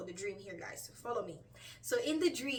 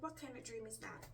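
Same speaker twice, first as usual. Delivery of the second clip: much softer, puzzled musing; 11 dB quieter than usual. Retold or imitated. Woman wondering about her dream, quietly puzzled. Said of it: "I was really puzzled".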